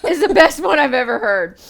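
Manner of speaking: high, laughing delivery; amused friend laughing at the analogy. Real, not acted. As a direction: say it through laughter.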